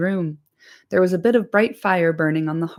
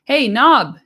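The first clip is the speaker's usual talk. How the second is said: higher, louder call across the inn; shout for Nob; 5 semitones higher, 7 dB louder than usual. Acted. Landlord calling his servant Nob, shouted louder. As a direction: bellow for the servant. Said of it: "he shouted".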